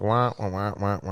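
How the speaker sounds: imitated parents' wah-wah drone; droning adult voice. Kid tuning out parents' talk, mock droning adult voice. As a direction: mimic grown-ups as meaningless wah-wahs. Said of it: "whenever parents talk, it's just like".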